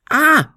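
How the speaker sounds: very high, loud short shriek; a sudden fright scream; shrill scream. A narrator's reading. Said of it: "Ein spitzer Schrei". (spitzer, lauter Schrei)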